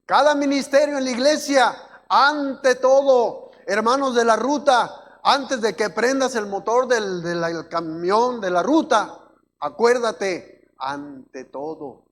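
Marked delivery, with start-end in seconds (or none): 0.0-10.7 loud, raised preacher's delivery with stretched key words; full-force preaching. Real, not acted. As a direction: exhort the congregation, voice lifted and loud.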